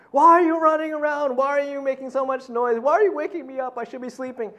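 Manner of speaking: loud, high mock scolding of the kids; grumpy rebuke. Father woken early scolding his daughters, mock-angry yelling. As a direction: bark at the noisy kids like a woken dad. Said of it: "I start rebuking".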